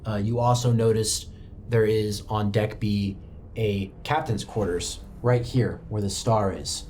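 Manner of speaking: ordinary delivery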